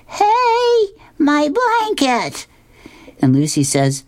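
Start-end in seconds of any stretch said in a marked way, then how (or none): 0.0-2.5 shrill high scream, then slow; high-pitched cartoon scream. A narrator's reading. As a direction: wail as the blanket gets snatched. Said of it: "he screams out".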